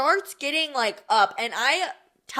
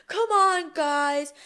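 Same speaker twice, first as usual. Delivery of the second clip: whiny plea; 7 semitones higher, slower than usual. Retold or imitated. Carl pleading, laughing teller.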